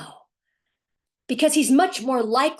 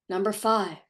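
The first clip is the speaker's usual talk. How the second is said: normal talking voice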